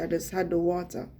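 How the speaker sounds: ordinary talk, nothing marked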